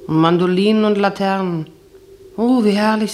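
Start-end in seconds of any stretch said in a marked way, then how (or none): 2.4-3.1 entzückter Ausruf. Retold or imitated. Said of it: "sagte ich".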